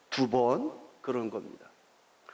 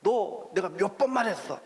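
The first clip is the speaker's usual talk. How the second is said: higher, exasperated reproach; irritated dad; 5 semitones higher than usual. Retold or imitated. Father scolding son who fell again, higher and irritated. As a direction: let the irritation rise in pitch. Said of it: "이게 화가 나는 겁니다".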